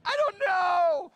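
put-on high, slow, crying kid's voice with a laugh through it; tearful kid. Boy to his coach, blubbering and crying. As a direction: wail it like a sobbing eight-year-old. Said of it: "And I was like".

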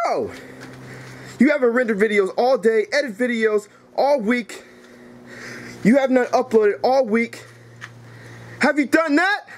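heated list of complaints, climbing at the end; exasperated scolding; angry rant. Acted. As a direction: hammer a friend with accusations. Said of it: "Knuckles, you need to calm down".

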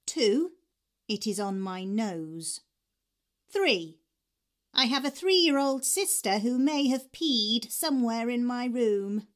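ordinary delivery

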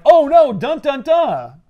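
slow, loud cliffhanger chant; mock dramatic sting. Reader reacting to a cliffhanger, hammed-up sting. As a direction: ham up the suspense. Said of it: "you're like".

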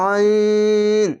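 আইন with a long held vowel and ringing n; chant-like, drawn-out আইন; slightly thick. Shown as a demonstration. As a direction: stretch আইন level, from the throat. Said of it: "একটু মোটা করে পড়বেন".

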